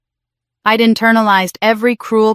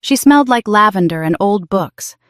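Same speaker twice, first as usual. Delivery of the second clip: usual speaking voice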